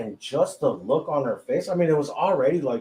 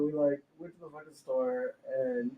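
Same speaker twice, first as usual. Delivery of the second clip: ordinary talk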